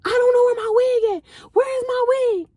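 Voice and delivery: put-on high drunk girl panicking; drunk panic. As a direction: wail it high like a lost drunk friend.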